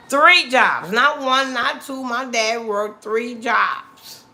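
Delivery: loud, high, hammering THREE JOBS; emphatic proud insistence. Real, not acted. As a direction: drive the count home forcefully. Stresses how hard dad worked.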